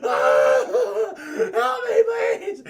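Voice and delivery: high, loud strained cry for help; mock screaming. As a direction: scream in hammed-up agony. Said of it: "he's screaming".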